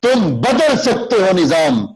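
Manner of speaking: high, slow call to the listener; slow oratorical exhortation; rousing address. Shown as a demonstration. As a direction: speak straight to them and stir them. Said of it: "اب دیکھیں، سامنے ہے".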